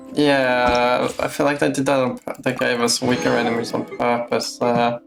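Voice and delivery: ordinary talk, nothing marked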